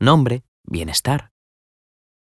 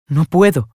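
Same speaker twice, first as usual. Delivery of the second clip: fading no puedo; 8 semitones higher than usual. A narrator's reading. Nela giving up her plea, falling voice. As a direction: let the refusal sink. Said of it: "murmuró Nela".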